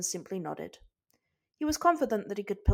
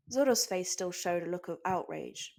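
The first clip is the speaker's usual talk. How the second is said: ordinary delivery, nothing marked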